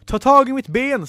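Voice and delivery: high, loud order; urgent shout. Acted. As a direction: yell for a friend to grab hold. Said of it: "skrek Ella".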